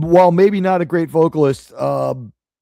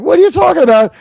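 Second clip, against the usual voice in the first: higher and louder baffled question; retold disbelief; 10 semitones higher, 8 dB louder, faster than usual. Retelling his reaction to his mom, higher and louder. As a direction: react in mock shock. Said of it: "And I'm like".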